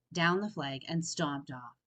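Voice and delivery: usual speaking voice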